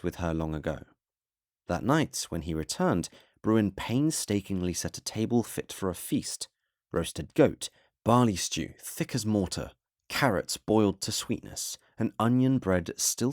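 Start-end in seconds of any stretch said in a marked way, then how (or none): none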